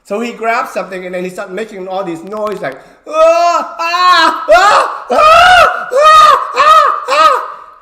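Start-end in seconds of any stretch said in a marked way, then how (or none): 3.1-7.7 very high, very loud fake effort screams; loud fake yells. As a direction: overdo the grunts like a fake wrestler. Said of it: "like really loud noises".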